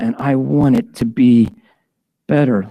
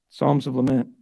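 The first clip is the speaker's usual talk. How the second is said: usual speaking voice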